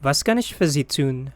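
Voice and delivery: Was kann ich für Sie tun said quickly at full speed; natural speed. Shown as a demonstration. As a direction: rattle it off like a native. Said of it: "phrase said at natural speed first". (quick native-speed read)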